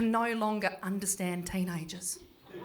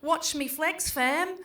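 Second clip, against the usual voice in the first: put-on higher, building louder try-hard teen talk; mock teen slang; 5 semitones higher, 5 dB louder, slower than usual. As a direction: ham up the slang to sound cool. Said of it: "I was like".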